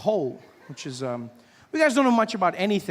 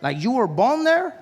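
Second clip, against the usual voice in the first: higher, louder astonished query; incredulous question; 5 semitones higher, 5 dB louder than usual. Retold or imitated. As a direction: ask as if it cannot be true. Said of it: "He's like".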